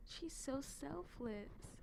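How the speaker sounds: slow breathy whisper of awe; awed whisper. Retold or imitated. Vlogger moved by the heroine, slow awed whisper. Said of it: "I was like".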